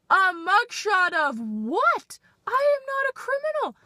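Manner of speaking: high, loud indignant squawk; mock outrage at the mugshot request; outraged. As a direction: shriek it in disbelief. Outraged inner reaction.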